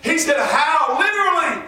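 high, loud pulpit cry; full-force preaching. Real, not acted. Preacher describing a dog baying at the moon, full-force preaching. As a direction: build urgently toward a howl.

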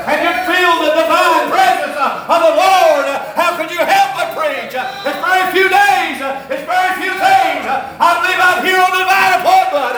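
high, loud fervent preaching; preaching at full force. Real, not acted. Preacher pouring out his heart to the congregation, fervent loud preaching.

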